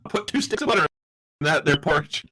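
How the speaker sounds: put-on southern accent. GM joking about the porridge in a southern accent. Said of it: "my brain went southern".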